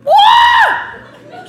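very high shouted yelp; cartoonishly high cry of surprise; astonished yelp. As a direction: shoot up high in mock astonishment. Man heard through the door, surprised.

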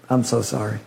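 low, hesitant sorrowful apology; hesitate, then apologise softly; somber messenger. Acted. Messenger breaking news of the children to Job, hushed and sorry. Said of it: "comes in somber, looking very down".

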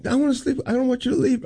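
high little-kid plea, lightly imitated; a hint of a child begging at bedtime; pleading child. Upset daughter begging dad for his bed, lightly voiced pleading.

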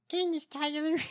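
high, put-on Candace accent; Candace's accent. Host trying the governor as Candace, high put-on accent. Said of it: "if you give her, uh, uh, Candace's accent".

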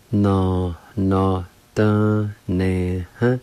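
"No, no, dun, ne, huh" in separate syllables with short breaks; measured teaching repeat of "No, no, dun, ne, huh", syllables held apart; syllable-by-syllable demo. Shown as a demonstration. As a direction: sound out "No, no, dun, ne, huh" piece by piece. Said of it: "is pronounced like this".

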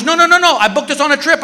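a lightly voiced eager wife.

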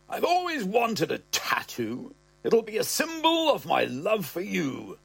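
put-on way up high, delighted old man; the old man, chuffed, in a high piping voice; old man's squeaky voice. Old dad to son, thrilled: high piping.

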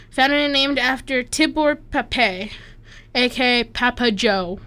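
ordinary talk, nothing marked

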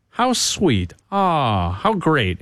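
mock-sweet sarcastic gushing. Real, not acted. Radio host on a reunited family, sarcastic. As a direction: coo it with obvious sarcasm. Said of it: "hear the sarcasm in my voice".

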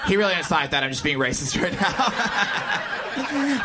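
ordinary delivery

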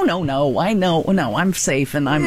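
ordinary delivery

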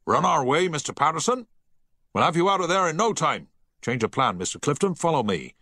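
firm naval command; the captain, commanding. A narrator's reading. Captain orders the crew to the lifeboats, commanding.

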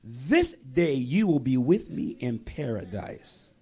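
slow, low solemn assurance; solemn promise. Retold or imitated. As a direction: voice the Lord's promise slowly and deeply. The Lord answers the thief.

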